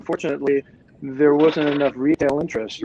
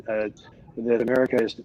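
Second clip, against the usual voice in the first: ordinary delivery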